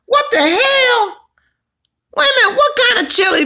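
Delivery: high, incredulous what the hell; playfully shocked at the chili news; surprised. Real, not acted. Reacting to viewer's chili comment.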